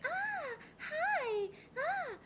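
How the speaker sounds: high, sugary shop-assistant greeting; customer-service voice. Retold or imitated. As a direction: chirp a fake-friendly hello to a customer. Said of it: "I'm like, I'm very".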